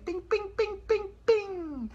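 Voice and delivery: very high ringing pings; repeated tiny bell-like bings; bing sound effect. Acted. Comic punctuating a shirt remark, high pinging noises.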